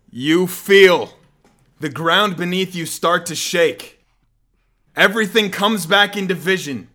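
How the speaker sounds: loud, rising storyteller intensity; dramatic narration. Acted. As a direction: build the chaos as you narrate. GM narrates the earthquake.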